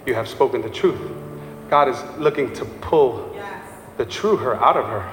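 low, soft, intimate appeal; tender preaching. Acted. Preacher softly explains God's heart to the congregation, tender. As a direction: draw them close gently.

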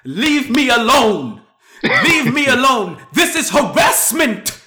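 high, loud, slow indignant Madea voice; Madea-esque response. Retold or imitated. Man telling the lady off, Madea-style slow shout. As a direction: drag the words out like Madea. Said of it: "the most Madea-esque response".

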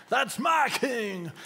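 high, slow, booming preacher's cry. Retold or imitated. Introducing the preacher, crying out with joy. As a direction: call it out like a fired-up Pentecostal preacher. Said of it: "pre-word to a preach in a kind of Pentecostal".

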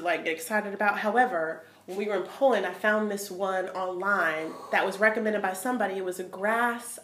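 ordinary talk

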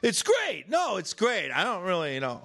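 the rude guy yapping on his phone in the cinema. Retold or imitated. Loud moviegoer answering phone.

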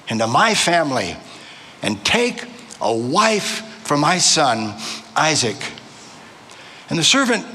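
deliberate reading with long pauses and sweeping pitch; scripture reading. Real, not acted. Preacher reading Genesis aloud to the congregation, measured and dramatic. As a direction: read it out measured, lifting key phrases.

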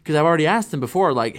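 usual speaking voice

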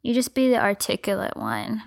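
breathy whispered sultry line. Shown as a demonstration. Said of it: "let me be the sultry one".

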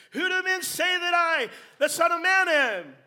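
loud, high ringing question; Jesus demanding. Acted. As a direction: call it out like a challenge to the crowd. Jesus questions his disciples.

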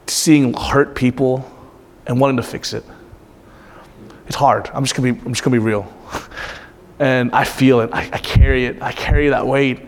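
low, hushed and halting, really moved; emotional. Real, not acted. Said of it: "I don't know why I got emotional".

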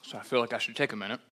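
talking while chewing chocolate; words round a bite of chocolate; mouth full. Real, not acted. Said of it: "Don't talk with your mouth full".

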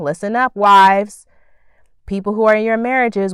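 calling out. Real, not acted. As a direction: grab the wives' attention, loud on wives. Calling out to wives.

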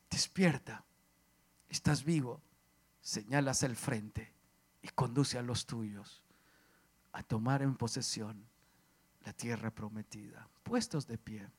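low, soft preacher's appeal; hushed exhortation. Acted. Preacher calling families to the promised land, hushed and solemn.

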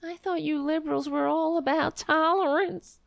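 high, sneering mock voice of a critic; mock conservative. Mocking an imagined conservative heckler, high sneering voice.

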